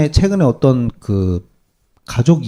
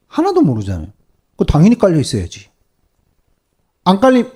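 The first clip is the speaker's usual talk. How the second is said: ordinary talk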